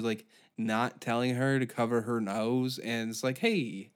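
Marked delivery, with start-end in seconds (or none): none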